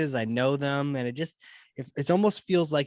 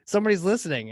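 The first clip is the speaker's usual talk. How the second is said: higher-pitched, lightly acted thrill; retold excitement; 6 semitones higher than usual. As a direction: act out your delighted reaction to being heard. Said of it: "I'm even more excited 'cause I'm like".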